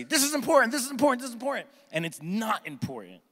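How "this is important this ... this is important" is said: mock urgent voice. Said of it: "someone declares urgency".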